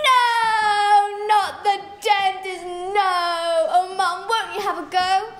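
loud, high protest that fades into begging; pleading shout. Acted. Child begging mother not to send him to the dentist, loud pleading. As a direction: howl no at the thought of the dentist. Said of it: "I shouted".

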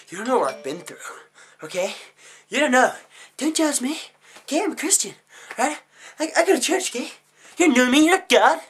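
building from indignant to high, loud yelling; hammed-up hysterical outburst; mock defensive meltdown.

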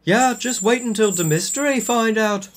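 high-pitched taunting voice; vampire's yell. A narrator's reading. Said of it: "Vampire yelled".

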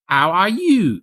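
loud, high mock-Cockney greeting; put-on Cockney with no breath on the H; exaggerated Cockney flower girl, swooping up high; Cockney, dropped H. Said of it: "no air coming out when you say the H".

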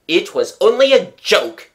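loud, high indignant roar. A narrator's reading. Father defends his joke to family, roaring. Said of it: "he roared".

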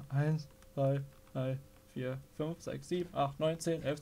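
soft, held-breath counting; hushed counting. Real, not acted. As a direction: count under your breath. Counting quietly while hiding.

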